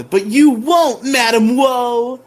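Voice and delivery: high, loud and slow hero shout; drawn-out heroic call at the villain; heroic taunt. Retold or imitated. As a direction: belt it like a cartoon hero. Billy's hero line to villain.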